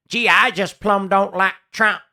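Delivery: hammed-up folksy yokel. Host boiling down the pundit's point, mock yokel voice. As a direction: play a dim country bumpkin for laughs. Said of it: "What you basically said is".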